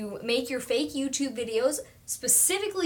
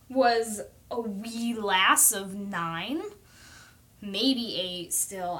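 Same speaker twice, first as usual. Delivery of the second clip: drawn-out storytelling; 2 semitones lower, much slower than usual. Real, not acted. Mock-storybook memory.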